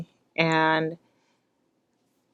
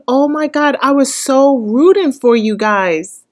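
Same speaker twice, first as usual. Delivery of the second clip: louder, higher exclamation of letdown; disappointed outburst; 6 semitones higher, 5 dB louder than usual. Real, not acted. Viewer telling the couple she rooted for them, louder letdown. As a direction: blurt out the letdown.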